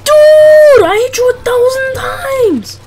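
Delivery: furious gamer yell; angry shout. Real, not acted. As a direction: yell it at the screen. Gamer yelling at opponent.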